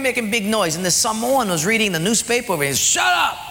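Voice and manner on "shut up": high-pitched shout to silence them; shouted order. Acted. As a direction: yell at noisy strangers to stop. Samoan snaps at noisy talkers.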